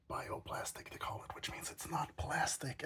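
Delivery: soft unvoiced whisper. Real, not acted. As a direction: whisper it under your breath. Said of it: "can you hear me when I'm whispering".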